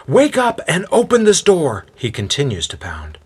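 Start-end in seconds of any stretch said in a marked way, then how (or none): 0.1-1.9 high, loud demanding shout; insistent wake-up yell. A narrator's reading. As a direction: holler it up high through a shut door. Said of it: "he yells out".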